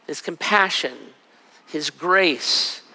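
ordinary talk, nothing marked

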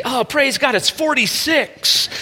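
a high, slow, overjoyed thanks to God for mild weather; grateful Ohioan. Retold or imitated. Pastor mimics Ohioans thankful for forty-six degrees, mock-joyful. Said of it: "would you look and say".

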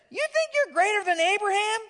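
incredulous mock outrage, pitched high; scornful challenge. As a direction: scoff at him as the indignant crowd. Crowd challenging Jesus.

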